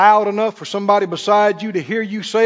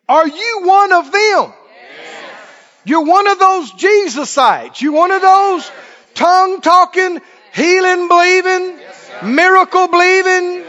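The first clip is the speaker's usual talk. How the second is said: mock interrogator; 7 semitones higher, 5 dB louder than usual. Scene: preacher plays an accuser questioning the congregation, shouting. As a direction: bark it at them like a persecutor.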